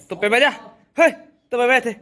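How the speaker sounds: high loud command to the dog; calling out. Real, not acted. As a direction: order the dog to sit. Telling the dog to sit.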